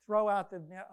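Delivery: Jesus telling Peter to cast the net, firm; firm command. Retold or imitated. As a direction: order it plainly.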